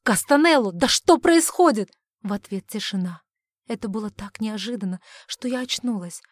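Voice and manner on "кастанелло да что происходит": high, alarmed shout; agitated outcry right by the ear; loud, agitated. Acted. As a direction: demand answers in a raised, worried voice. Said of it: "Громкий взволнованный голос прямо над ухом".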